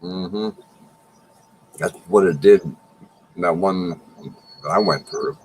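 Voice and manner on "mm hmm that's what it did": ordinary talk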